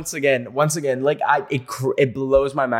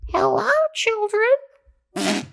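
put-on creepy old woman; much slower than usual. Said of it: "She said something like".

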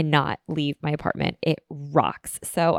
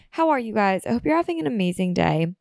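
normal talking voice

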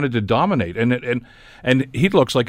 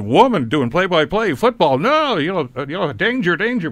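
higher-pitched, hammed-up panic; mock alarm; 7 semitones higher than usual. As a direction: imitate a mind thrown into comic alarm. Acting out a viewer's startled mind.

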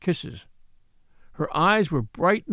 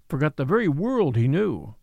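ordinary delivery

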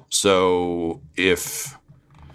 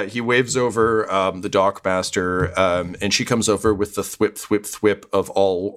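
ordinary talk

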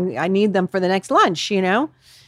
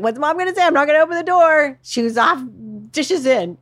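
high, raised, insistent mom voice; insistent drilling; 7 semitones higher, 4 dB louder than usual. Retold or imitated. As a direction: drill the rule into the kids. Mom drilling kids at the door.

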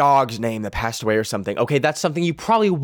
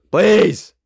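louder, higher insistent PLEASE; emphatic plea; 6 semitones higher, 6 dB louder than usual. Real, not acted. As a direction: beg harder, pushing "please" up and out.

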